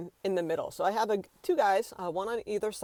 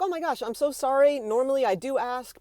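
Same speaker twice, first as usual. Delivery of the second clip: higher-pitched, gushing apology; apologetic; 5 semitones higher than usual. Acted. Shopper answers a polite store worker, apologetic. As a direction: apologize earnestly in a raised pitch. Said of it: "I would have been like".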